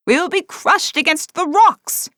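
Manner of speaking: high, loud frightened cry; panicking first mate; fearful. A narrator's reading. First mate fears the rocks.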